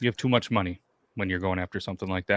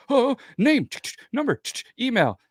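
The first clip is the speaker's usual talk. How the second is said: flamboyant clerk; 13 semitones higher than usual. Retold or imitated. Clerk rattling off fields.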